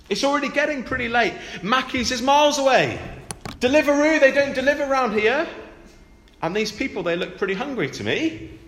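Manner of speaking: a hint of put-out disciples pleading with the boss; miffed disciples. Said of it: "and they say to him".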